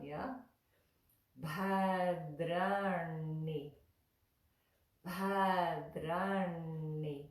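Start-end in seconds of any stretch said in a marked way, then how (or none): 1.4-7.2 "bhadrani" drawn out syllable by syllable; pronunciation demo. Shown as a demonstration. Teacher showing the tricky third line, drawn-out "bhadrani". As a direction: sound out "bhadrani" slowly and fully. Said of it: "tongue's moving all around".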